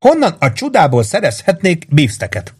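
high, swooping, indignant question; high-voiced woman, incredulous; exclaiming in disbelief. A narrator's reading. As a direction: protest in astonishment. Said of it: "kiáltott fel Missus Kipfer".

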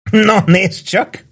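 high, slow, tipsy teasing; playful high-pitched drunk; drunken chuckling. A narrator's reading. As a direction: draw it out in drunken amusement. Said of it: "kuncogott részegen Warden".

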